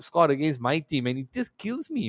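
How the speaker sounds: ordinary delivery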